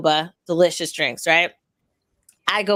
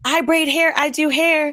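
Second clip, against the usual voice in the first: bubbly; 6 semitones higher than usual. Retold or imitated. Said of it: "She's one of those bubbly people".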